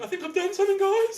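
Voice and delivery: very high, loud yell; loud, high mock cry to friends; calling out. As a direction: shout it to friends across the river.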